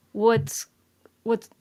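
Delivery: ordinary talk, nothing marked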